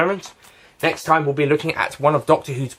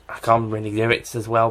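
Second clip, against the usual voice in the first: normal talking voice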